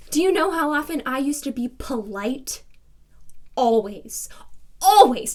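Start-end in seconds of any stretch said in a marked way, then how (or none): 4.8-5.3 outburst. Acted. Woman insisting to a reporter on past politeness, exasperated outburst. Repeats the word, escalating.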